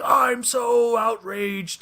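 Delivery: high, loud, drawn-out complaint; hammed-up outrage for the street interview; mock outraged Karen. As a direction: wail it out like an indignant protester. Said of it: "the first Karens I ever saw".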